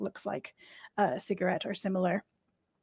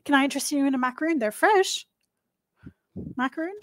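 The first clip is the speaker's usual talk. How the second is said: eager baker offering treats; 5 semitones higher, much faster than usual. Acted. Baker offers macaroons to players.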